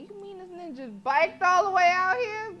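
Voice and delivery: can't believe he biked here; loud, amazed outburst. Real, not acted. Amazed at the tiny bicycle.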